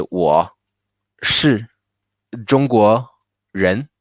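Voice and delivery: 我, 是, 中国, 人 each alone with a clear pause after it; word-by-word split. Shown as a demonstration. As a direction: chop 我是中国人 into isolated words for a listener. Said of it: "if you do that very individually".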